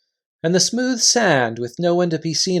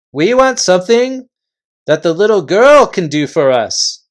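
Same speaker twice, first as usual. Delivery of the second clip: loud, high chieftain calling a halt; gruff invisible chief; 4 semitones higher, 6 dB louder than usual. Acted. Invisible chief halting the Narnians, loud and high. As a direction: bark the warning from thin air.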